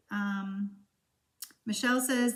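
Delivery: usual speaking voice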